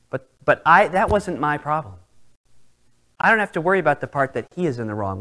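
ordinary delivery

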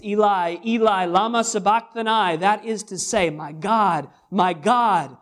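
loud anguished cry. A narrator's reading. Preacher voicing Jesus crying out on the cross, loud and drawn out. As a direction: cry it out to heaven. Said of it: "He cried with a loud voice".

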